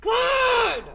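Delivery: sudden loud yell; shout. Real, not acted. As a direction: bark it out loud.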